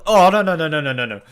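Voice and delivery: loud, high stream of no's; panicked pleading. Real, not acted. Player watching the finger go in, panicked no's. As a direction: beg desperately, rising panic.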